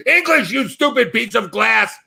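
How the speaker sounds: high-pitched angry insult; exasperated outburst. Acted. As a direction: lose patience with a useless mirror. Loses temper at the mirror.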